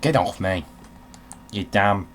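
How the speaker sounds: normal talking voice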